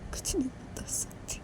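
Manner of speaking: breaking down into a whisper; tearful whisper. Acted. Daughter hiding the truth from her mother, whispering tearfully. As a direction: whisper it through tears, slowly. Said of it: "Manisha फिर से रोने लगती है".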